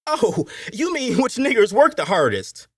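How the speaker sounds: high-pitched Appalachian overseer; overseer catching on. A narrator's reading. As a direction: play a coarse overseer, pitched well up.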